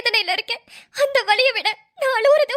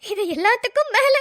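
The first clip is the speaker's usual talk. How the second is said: ordinary talk, nothing marked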